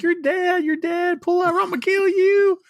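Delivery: high, loud hammed-up threat; squealing mock death threat; mock yelling. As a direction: scream the threat across the car. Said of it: "he's yelling at him".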